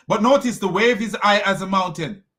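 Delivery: ordinary talk, nothing marked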